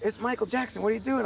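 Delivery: high-pitched star on the phone; put-on high voice, casually inquiring. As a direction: imitate a high, demure caller. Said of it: "He goes".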